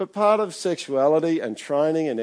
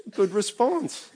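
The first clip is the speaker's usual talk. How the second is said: delighted praise; 10 semitones higher, much slower than usual. Real, not acted. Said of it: "That's a good response".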